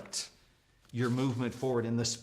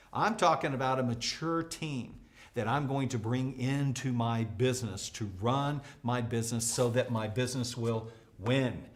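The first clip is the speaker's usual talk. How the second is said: usual speaking voice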